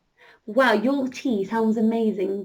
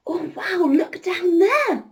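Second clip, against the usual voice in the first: higher cry of wonder, slow on look down there; wonder-struck cry; 5 semitones higher than usual. Acted. Scarlett marvelling at the land below, crying out. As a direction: call out in amazement at the view. Flying over mountains, sees people.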